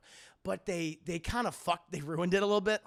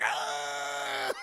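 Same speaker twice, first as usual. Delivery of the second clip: Emperor's scream; 3 semitones lower than usual. Retold or imitated. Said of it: "he's like".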